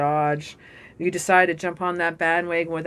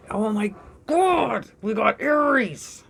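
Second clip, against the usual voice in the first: high, drawn-out exclamation of amazement; excited disbelief; 5 semitones higher, slower than usual. Real, not acted. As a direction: stretch it out, high and thrilled. Reacting to the Aries card.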